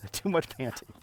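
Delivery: laughing at the panting dog. Real, not acted. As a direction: chuckle through it.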